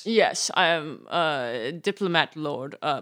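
put-on dignified British accent; British lord. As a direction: play a very dignified English lord.

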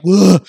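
loud groan of frustration; a big exasperated grunt; exasperated ugh. Retold or imitated. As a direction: let out a fed-up ugh. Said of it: "And I'm like".

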